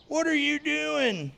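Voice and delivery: high, slow squeaky complaining voice; body yelling back. Pretend. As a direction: voice the body whining at you. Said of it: "my body would be yelling at me for it".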